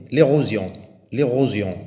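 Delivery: L'érosion loud, with the s voiced as z: lé-ro-zion. Shown as a demonstration. Teacher showing pupils the z sound, loud and clear. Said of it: "le S se prononce Z".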